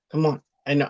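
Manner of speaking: ordinary delivery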